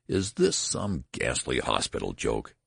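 understated rage, dropping in pitch; enraged. A narrator's reading. Father accusing the nurse of a joke, mildly enraged. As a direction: demand it with contained fury. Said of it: "terror resolving into rage".

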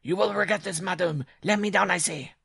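loud, high-pitched enraged dwarf; furious scream. A narrator's reading. Dwarf threatening bride who holds him, loud furious cry. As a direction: threaten her at high pitch, really forceful. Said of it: "screamed in a paroxysm of fury".

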